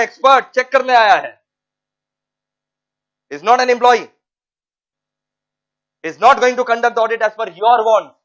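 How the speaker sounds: forceful, loud point-making; emphatic loud lecturing. Real, not acted. Teacher to class, loud emphatic insistence. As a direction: drive home that auditors are independent.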